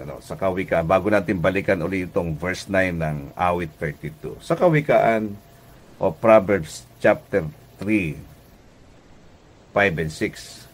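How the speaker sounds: ordinary talk, nothing marked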